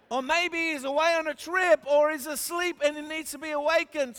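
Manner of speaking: high, teasing taunt; lifted, scoffing pitch; mocking. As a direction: pile on the mock suggestions. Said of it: "Elijah began mocking them".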